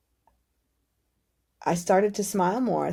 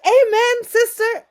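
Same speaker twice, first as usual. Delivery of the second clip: much higher and louder, churchy cry; exultant amen; 10 dB louder, slower than usual. Retold or imitated. Friend hails her glass vision, loud high exultant cry. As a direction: shout it like a revival praise.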